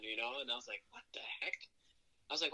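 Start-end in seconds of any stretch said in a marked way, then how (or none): 0.9-1.6 whisper. Retold or imitated. Baffled aside about new name.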